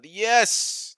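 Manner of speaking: loud, high burst of relief; triumphant shout. Real, not acted. Streamer whose code just worked, triumphant shout. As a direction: shout it as it finally works.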